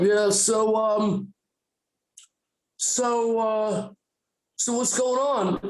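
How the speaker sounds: acted nervous filler; awkward small talk. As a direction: stall awkwardly, pretending all is normal.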